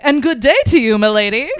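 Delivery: loud, hammed-up British reply; British accent. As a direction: proclaim it in a mock British accent. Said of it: "[name] Britishes in reply".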